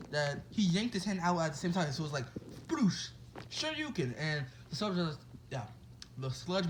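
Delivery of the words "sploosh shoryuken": high-pitched mock battle cry; punchy imitated impact, then high attack shout without force. Deku punches the sludge monster, attack cry.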